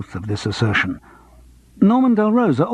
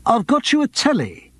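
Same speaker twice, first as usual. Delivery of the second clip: high, proud announcement; a touch of a pompous agent boasting; lightly grand agent bragging; 11 semitones higher than usual. A narrator's reading. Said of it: "said Morris grandly".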